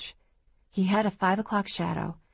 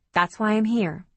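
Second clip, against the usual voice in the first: higher-pitched, defensive retort; mildly defensive, pushed up in pitch; 4 semitones higher, 4 dB louder than usual. Acted. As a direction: justify yourself, a touch too insistently. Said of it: "I said defensively".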